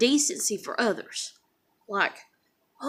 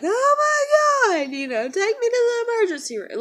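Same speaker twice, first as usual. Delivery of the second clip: mock wailing; 12 semitones higher, 5 dB louder than usual. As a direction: ham up an over-the-top wail. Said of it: "we'd be like".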